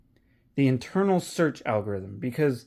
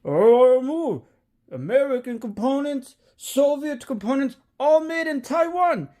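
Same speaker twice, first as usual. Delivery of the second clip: high, loud hammed-up Russian accent, pitched well up; Russian accent impression; 10 semitones higher, 3 dB louder than usual. Vlogger retelling Armageddon, doing the Russian guy shoving her aside, in a hammed-up Russian accent. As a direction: bark it like a brash Russian cosmonaut in a mock Russian accent. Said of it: "the Russian guy goes".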